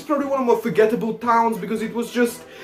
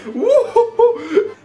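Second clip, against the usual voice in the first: high hooting laugh; 11 semitones higher, much slower than usual. Real, not acted. Joking about travelling back in time, slow high woo-hoo hoot.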